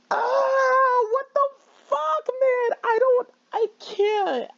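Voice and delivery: high, breaking voice near tears; real frightened crying. Real, not acted. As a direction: sob it out in real horror. Horrified by the bloody poem.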